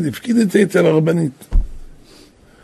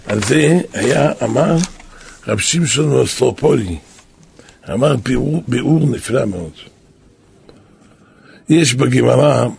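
normal talking voice

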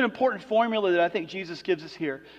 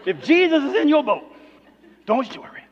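a hammed-up preaching voice, swelling louder in the middle; mock preacher; 6 semitones higher, 6 dB louder than usual. Pastor imitating a typical sermon, mock preaching. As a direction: lay on the pulpit style for the congregation. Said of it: "it really preaches".

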